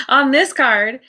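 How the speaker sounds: real high-pitched laughing surprise; amused surprise. Real, not acted. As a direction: laugh through the words, astonished. Same card turns up again.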